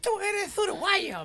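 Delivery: high, slow mock Galician lady; Spanish shopkeeper. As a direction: squeak it like the Spanish grocer. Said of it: "Me dice".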